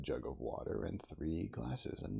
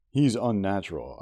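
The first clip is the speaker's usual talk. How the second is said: emphatic; 4 semitones higher, 13 dB louder than usual. A narrator's reading. Blunt emphatic verdict.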